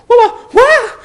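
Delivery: very high, loud stammer through a laugh; stumbling falsetto in shock; stammering Hermione. Acted. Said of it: "she stumbled".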